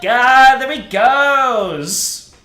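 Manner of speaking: high, loud, slow squeal; screaming fangirl. Acted. Fans squealing as the rock star leaves the park. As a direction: shriek after him as he leaves. Fans watching him ride off.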